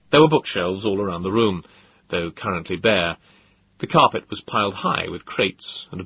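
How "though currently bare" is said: plain reading voice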